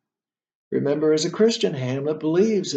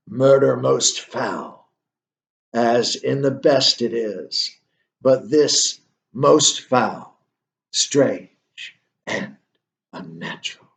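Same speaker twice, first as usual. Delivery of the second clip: slow, sinking recitation fading to a breath; ghost's lament; 3 semitones lower, slower than usual. Retold or imitated. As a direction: let it sink lower and die away. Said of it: "And Hamlet cries out".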